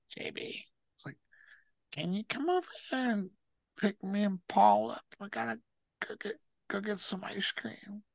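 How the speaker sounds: soft, half-whispered mock plea; imitating Bobby's whisper. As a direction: murmur it like a sly friend asking a favor. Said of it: "He's like".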